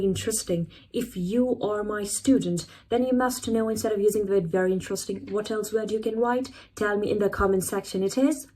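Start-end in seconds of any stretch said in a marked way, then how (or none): none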